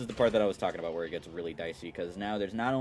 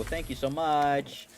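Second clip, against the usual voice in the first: sweet thanks; 8 semitones higher, 5 dB louder, slower than usual. Retold or imitated. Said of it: "I'm gonna go".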